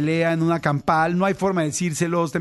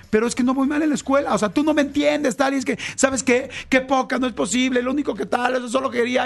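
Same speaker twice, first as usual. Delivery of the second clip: put-on teen outburst; 7 semitones higher than usual. Daughter erupting at her mother, high and exasperated. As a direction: protest like a hurt teenager slamming the door.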